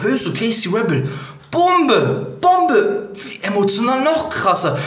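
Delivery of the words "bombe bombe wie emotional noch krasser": excited outburst. Real, not acted. Hype reaction to a surprise feature.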